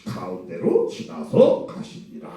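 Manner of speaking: holy scripture tone. Shown as a demonstration. Preacher to congregation, contrasting blunt and holy wording, slow and hushed. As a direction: intone it like holy writ. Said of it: "이렇게 거룩하게 쓴 거예요".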